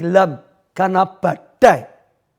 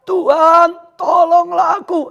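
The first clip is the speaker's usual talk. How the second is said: loud, high, drawn-out shout; crying for help; 11 semitones higher, 5 dB louder, slower than usual. Acted. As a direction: cry out to the Lord like Peter. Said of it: "Lalu teriak". (berteriak minta tolong)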